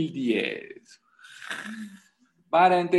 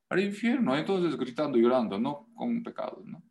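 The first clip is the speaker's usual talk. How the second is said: ordinary talk, nothing marked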